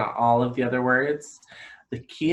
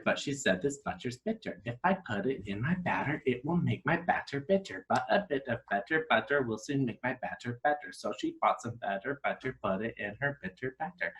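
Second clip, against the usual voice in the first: quick, continuous run through the words; fast tongue twister. Shown as a demonstration. Teacher seeing how fast she can say the tongue twister, fast. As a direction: race through it without stopping between words. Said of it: "let's see how fast we can do it".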